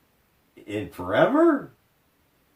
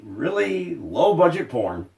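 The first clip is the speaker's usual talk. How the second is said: ordinary talk, nothing marked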